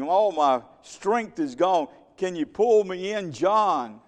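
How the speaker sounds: high, desperate cry to his brothers on the boat; panicked plea, pitched high, a little slowed; Peter calling for help. Retold or imitated. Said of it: "He didn't say to his brother".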